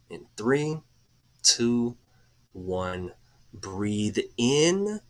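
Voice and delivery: guided breathing. Acted. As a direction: ease the listeners through each breath, unhurried. Leads a guided breathing exercise.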